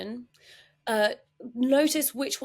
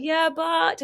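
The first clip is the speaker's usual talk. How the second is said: high, loud mock objection; resistant objection; 8 semitones higher, 6 dB louder than usual. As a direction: push back on the advice, pitched up. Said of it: "And they're like".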